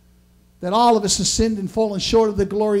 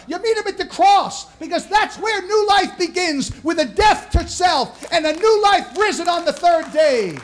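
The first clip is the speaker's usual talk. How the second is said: full-force preaching; 8 semitones higher than usual. Acted. Pastor preaching to the congregation, full-force sermon. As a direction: lift the room, hammer each phrase like a revival preacher.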